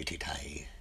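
whispered ti-tai, breathy and soft. Shown as a demonstration.

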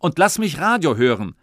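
loud irritated shout. Acted. Father shouting to hear radio, loud. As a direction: yell to be left alone. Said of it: "hat Papa gerufen".

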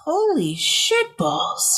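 slow, drawn-out astonished exclamation; floored by the news; stunned. Real, not acted. Shocked at follower gap.

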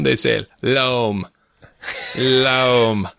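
LOAM slowly and distinctly, with an oh; slow, spaced "loam", rhymes with home; dictionary pronunciation loam. Retold or imitated. Builder imitates dictionary dot com audio, slow distinct loam. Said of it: "if you go to dictionary dot com".

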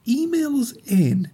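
high, slow call-out. Real, not acted. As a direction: announce it to the audience. Calling out to listeners.

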